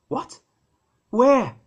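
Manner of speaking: sudden loud high question; shocked; surprised. Acted. Wife surprised by departure news.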